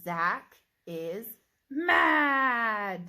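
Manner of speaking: loud shouted mad, voice high; shouted angry demo of mad; mad with excitement. Shown as a demonstration. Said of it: "read it with excitement".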